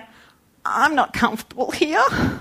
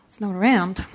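ordinary talk, nothing marked

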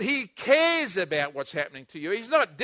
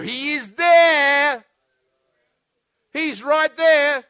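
very slow, loud and higher, each word stretched; forceful preaching; 6 semitones higher, 10 dB louder, much slower than usual. Real, not acted. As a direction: proclaim it with full conviction.

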